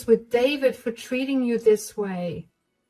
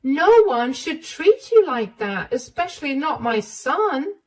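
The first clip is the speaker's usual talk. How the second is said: higher and louder, indignant; outraged mother; 9 semitones higher, 4 dB louder than usual. Acted. Mother furious at her son for hurting Emma, higher and louder. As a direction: let the anger burst out.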